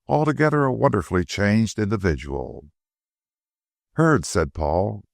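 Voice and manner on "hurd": a higher, startled exclamation; startled surprise. A narrator's reading. Paul recognizing the disguised detective, startled surprise. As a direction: blurt the name in surprise. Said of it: "starting with surprise".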